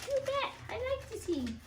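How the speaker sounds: very high squeaky puppet voice; bouncy kangaroo puppet. Pretend. Kangaroo Kite puppet talking to children, squeaky.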